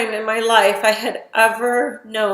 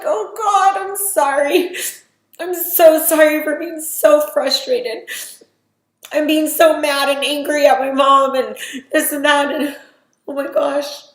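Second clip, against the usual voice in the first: higher and louder, voice straining with grief; distraught apology; 6 semitones higher, 6 dB louder than usual. Retold or imitated. Just told her mom died, apologizing in distraught high voice. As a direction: pour out guilt and shock, near breaking.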